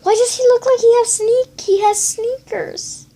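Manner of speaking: high, loud and astonished; surprised. Real, not acted. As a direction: exclaim in disbelief. Astonished at the picture.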